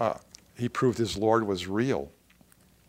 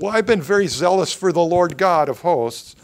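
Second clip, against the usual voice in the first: loud, higher self-defence; indignant protest; 5 semitones higher, 8 dB louder than usual. Acted. Elijah defends his zeal to God, loud protest. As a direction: protest it emphatically, raised voice.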